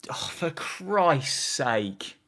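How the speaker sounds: slow whispered groan of despair; exasperated whisper. Real, not acted. Fan watching a dropped chance, slow exasperated whisper. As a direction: whisper it in disbelief.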